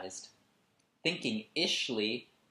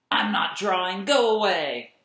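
loud, higher furious yell; angry shout; 9 semitones higher, 12 dB louder than usual. Acted. Boy yelling at his little sister, angry shout. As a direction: yell at her to leave.

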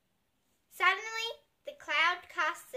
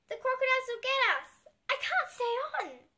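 panicky; 10 semitones higher, 4 dB louder than usual. A narrator's reading. Panicked children on swaying bridge.

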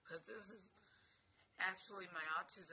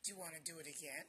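normal talking voice

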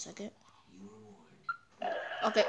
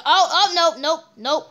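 startled; 7 semitones higher, 10 dB louder than usual. Real, not acted. Startled reaction.

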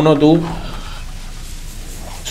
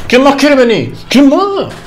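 high, cocky challenge; triumphant taunt; 10 semitones higher, slower than usual. Real, not acted. Player to enemies after a kill, triumphant taunting. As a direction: challenge all comers in a high voice.

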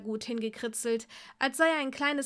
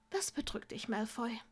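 softer, gentle asking; soft question; 6 dB quieter than usual. Acted. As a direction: ask quietly, leaning in close. Granger gently asks Draco.

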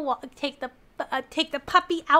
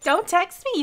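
high, loud protest; indignant burst, punching each repeat; outraged; 5 semitones higher, 9 dB louder than usual. Real, not acted. Streamer reacts to his text, outraged.

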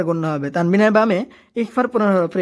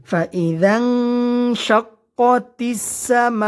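slow, melodic Quran recitation; 4 semitones higher, much slower than usual. Shown as a demonstration. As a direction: chant each word slowly with long vowels. Recites verse slowly.

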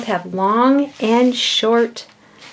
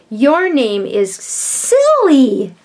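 very slow and louder, stretched for emphasis; drawn-out teasing exclamation, slow and louder; 4 semitones higher, 5 dB louder, much slower than usual. Shown as a demonstration. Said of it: "then I put an exclamation".

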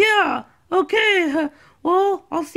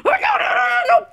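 panicked shouting; 10 semitones higher, faster than usual. Acted. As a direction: cry out in panic. She hits herself.

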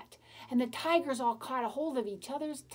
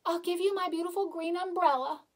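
high, loud, panicky begging; little boy begging a tiger in a storybook; frightened pleading; 7 semitones higher, 4 dB louder than usual. Acted. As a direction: beg the tiger for your life.

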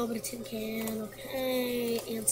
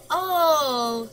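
sudden oh; 3 semitones higher, 11 dB louder than usual. Real, not acted. Sudden realisation in game.

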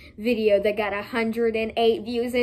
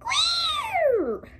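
very high, quick piercing squeals; squeaky little rodent impression; guinea pig squeaks; much slower than usual. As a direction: imitate squeaking guinea pigs, last one drawn out. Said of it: "They're going".

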